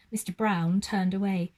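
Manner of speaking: usual speaking voice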